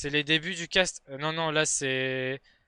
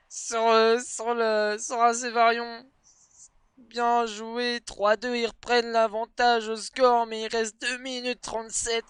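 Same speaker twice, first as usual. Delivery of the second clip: fast, excited play-by-play; esports caster; 5 semitones higher, 6 dB louder than usual. Acted. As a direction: hype up every goal. Live Rocket League match commentary.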